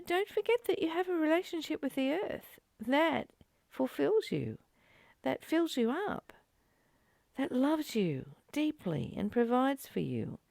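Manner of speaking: usual speaking voice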